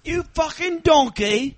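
high, hammed-up British heckle; loud cartoonish British insult; mock British accent. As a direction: abuse them in a put-on British yelp.

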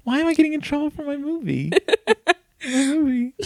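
very high, squeaky mock bewilderment; wronged innocence. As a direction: whine like a kid unfairly in trouble. Retold wounded innocence after dad leaves.

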